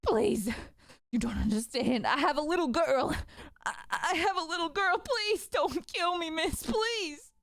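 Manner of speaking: high, tearful begging, slowing at the end; a weeping father begging the swordswoman for mercy; sobbing, begging. Acted. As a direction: plead for your life through sobs. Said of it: "full on sobbing now and begging for life".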